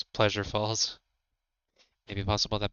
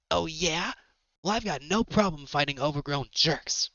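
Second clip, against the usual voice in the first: higher-pitched cocky hero; Spyro; 7 semitones higher than usual. Acted. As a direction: talk back in a high, scrappy hero voice. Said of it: "Spyro jumps in heroically".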